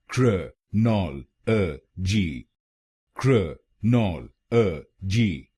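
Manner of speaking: क्र नॉल अ जी, each syllable apart, very slow. Shown as a demonstration. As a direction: spell it out slowly: krə, nɔl, ə, ji. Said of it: "तो बनेगा".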